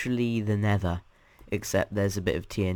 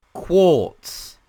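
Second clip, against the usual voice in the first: high, very loud one-word call; announcer call; 7 semitones higher, 12 dB louder than usual. Real, not acted. Host naming the next dimension for viewers, booming high announcement. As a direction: belt out the title.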